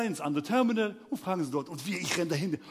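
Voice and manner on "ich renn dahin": usual speaking voice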